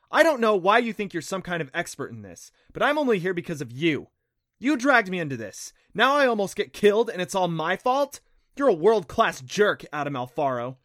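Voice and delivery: loud, high furious tirade; girl blasting the boy who blames her; angry outburst. Acted. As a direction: let him have it. Clancy stomps over, furious.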